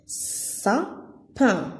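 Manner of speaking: "Sapin" split into two beats, sa, pin; syllable by syllable. Shown as a demonstration. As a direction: say "Sapin" with a clear pause between syllables. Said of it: "Deux syllabes où j'entends le son S". (« Sapin » découpé en syllabes)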